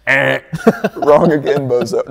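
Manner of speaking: loud, laughing taunt at the TV; mock gloating jeer. Watching the TV feed, jeering at a wrong pundit, loud and laughing. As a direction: jeer through laughter at the commentator. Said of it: "I'm like".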